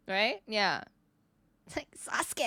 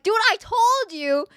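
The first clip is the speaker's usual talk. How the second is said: imitating girls' raised-voice told-you-so; insistent I-told-you; 7 semitones higher, 6 dB louder than usual. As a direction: crow it at a friend, stretching the key word. Quoting girls' gleeful I-told-you.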